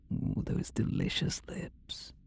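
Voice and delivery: very soft, voiceless whisper; relishing whisper. Acted. Demon tracing the captive's lips, relishing whisper. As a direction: whisper it, savoring the sight.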